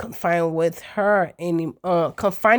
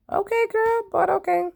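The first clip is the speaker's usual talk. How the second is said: sarcastic okay; 13 semitones higher than usual. Real, not acted. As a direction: say it with disbelief.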